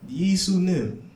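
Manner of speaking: Korean prayer. Retold or imitated. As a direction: plead slowly in mock Korean. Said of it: "a Korean prayer sounds like this".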